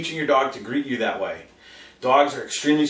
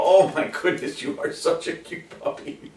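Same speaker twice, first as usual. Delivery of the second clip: gushing over puppy; 4 semitones higher than usual. Retold or imitated. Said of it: "We go".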